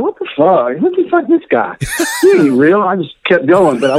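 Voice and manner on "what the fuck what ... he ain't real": shocked, high-pitched disbelief; can't believe your eyes; disbelieving. Retold or imitated. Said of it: "I said".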